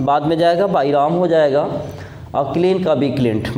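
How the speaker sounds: normal talking voice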